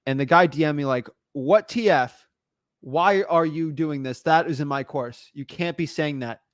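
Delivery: ordinary talk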